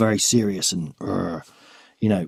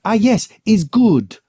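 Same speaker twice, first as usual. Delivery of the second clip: high, slow, louder mock British drawl; mum's British accent; 11 semitones higher, 5 dB louder, slower than usual. Son imitating his mum's British accent praising someone. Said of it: "she says, he's like".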